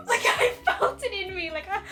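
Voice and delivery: high, laughing through the words; panicked laughter. Retold or imitated. Recalling seeing Enrique again, high nervous laughing.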